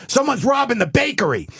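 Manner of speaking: high, urgent cop alert; a cop calls out the robbery, high and urgent; excited cop. Retold or imitated. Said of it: "A cop ... goes".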